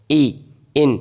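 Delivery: "ई, इन" as separate, deliberate syllables; teacher splitting "ई, इन" apart; broken into syllables. Shown as a demonstration. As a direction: sound out "ई", then "इन", each on its own. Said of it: "छोटे भागों में तोड़ सकें".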